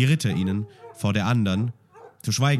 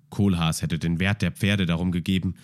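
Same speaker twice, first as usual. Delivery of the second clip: ordinary delivery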